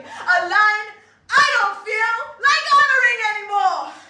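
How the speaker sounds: ranting defiance rising to a shout. Acted. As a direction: build to a defiant cry. Building rant climax.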